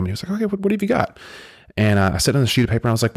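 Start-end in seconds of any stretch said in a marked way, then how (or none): 0.3-1.2 much higher, breezy professor voice; lightly mocking the teacher's welcome; voicing the professor. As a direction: play the casual professor up high.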